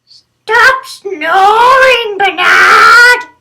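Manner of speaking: very high, loud, slow desperate wail; wailing shout. Acted. Exhausted neighbour wailing at Bernard to stop snoring, high and loud. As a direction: howl it out as if at the end of your rope.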